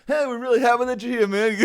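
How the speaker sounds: high, loud, bubbly host voice; impression of co-host. Mocking his co-host's sign-off, high and hammed-up. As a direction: ham up his friend's chirpy sign-off. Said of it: "this is my impression of your voice".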